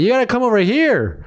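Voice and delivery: lightly voiced window guy; drive-thru worker. Retelling the drive-thru worker calling him over, lightly voiced. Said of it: "the guy's like".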